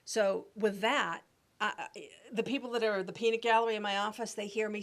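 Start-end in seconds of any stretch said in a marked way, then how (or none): none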